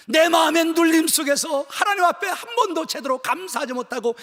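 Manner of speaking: loud, high, impassioned sermon; full-force preaching. Acted. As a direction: preach it out with fervour.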